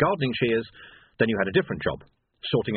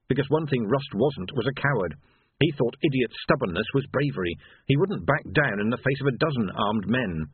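ordinary delivery

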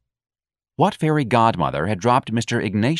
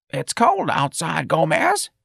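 put-on high, slow elderly complaint; protesting old woman; 9 semitones higher, slower than usual. A narrator's reading. Elderly employee objects to going out, querulous. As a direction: protest in an old lady's pitched-up voice.

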